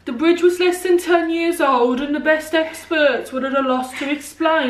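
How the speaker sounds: put-on Birmingham accent.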